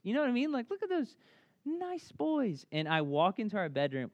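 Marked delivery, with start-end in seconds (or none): 0.7-2.6 doting dad. Retold or imitated. Dad admiring boys sharing.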